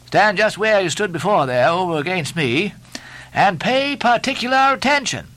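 high-pitched, insistent orders; Stubb, bossy mate. A narrator's reading. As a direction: lay down orders to the cook.

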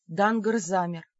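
usual speaking voice

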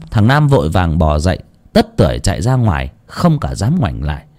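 usual speaking voice

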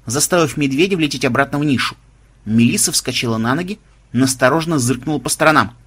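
ordinary delivery

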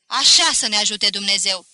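very loud, solemn cry; shouted oath. A narrator's reading. Student crying out after the prayer, shouted oath. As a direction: call it out to everyone. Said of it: "strigă".

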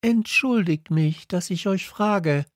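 put-on high, apologetic child voice; little prince politely to the king. A narrator's reading. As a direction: excuse yourself to a king. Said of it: "sagte er".

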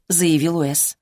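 ordinary delivery, nothing marked

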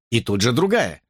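ordinary delivery, nothing marked

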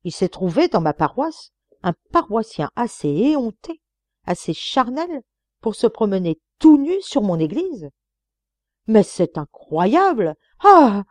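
loud, rising scandalized rant ending in a cry; indignant outrage. Acted. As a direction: rage at the shameless parishioner. Scandalized dean rants.

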